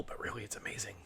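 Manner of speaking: hushed conspiratorial whisper. Real, not acted. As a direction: whisper it like a secret. Secret aside about Eau Claire.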